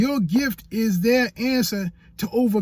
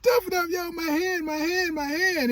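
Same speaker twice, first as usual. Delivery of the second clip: whiny mock sob, much higher; mock crying; 11 semitones higher than usual. Imitating himself whining to his wife about his hand, mock crying, much higher. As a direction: whimper it like a hurt kid, half playing. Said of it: "I'm crying".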